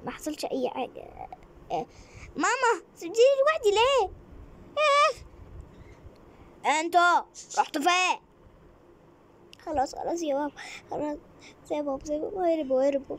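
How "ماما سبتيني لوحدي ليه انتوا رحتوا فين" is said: high, loud crying for mum; tearful call. Acted. Lost girl crying out for her missing mother. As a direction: wail for the mother who left.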